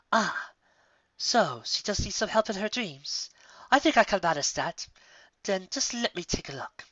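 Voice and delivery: a pleased princess offering help; Luna's voice. Acted. Luna voiced by narrator.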